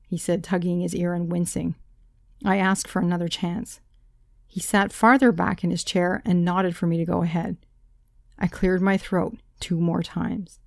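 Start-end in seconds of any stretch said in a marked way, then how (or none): none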